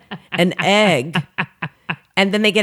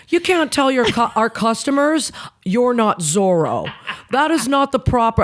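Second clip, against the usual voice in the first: high, affected manager reprimand; Jim, affected scolding; 6 semitones higher than usual. Retold or imitated. Manager correcting a waitress over a joke to customers, affected. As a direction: imitate an affected boss telling off staff. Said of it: "he talked like this, really affected".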